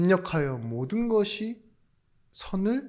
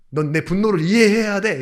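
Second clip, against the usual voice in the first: higher, louder mock rebuke; a hammed-up Joseph demanding his anger be understood; mock angry Joseph; 6 semitones higher, 7 dB louder than usual. Joseph scolding his brothers, hammed-up angry outburst. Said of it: "이러면서 설득시킨 게 아니에요".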